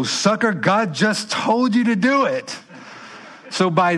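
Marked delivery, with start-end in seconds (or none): 0.0-2.6 mocking jab. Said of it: "look at him and go".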